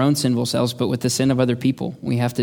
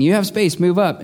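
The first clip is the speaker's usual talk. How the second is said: raised, higher mock yell at the driver; imitating a shout; 7 semitones higher than usual. Angry driver yelling at the woman ahead, mock shout. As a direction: bark it up high like an angry driver. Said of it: "started shouting at the woman".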